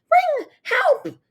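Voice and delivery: very high shrill shriek. A narrator's reading. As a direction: shriek for rescue. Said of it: "the Duchess shrieked".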